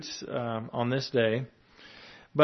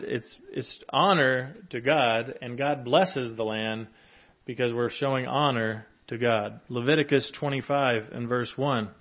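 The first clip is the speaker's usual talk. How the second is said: usual speaking voice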